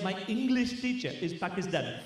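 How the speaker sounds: put-on Pakistani accent; punchline in a mock Pakistani accent. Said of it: "why are you talking with a Pakistani accent".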